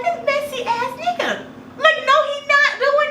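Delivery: high, laughing through the words; laughing disbelief. Real, not acted. Narrator laughing at Trevor calling out through the door. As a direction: crack up in disbelief.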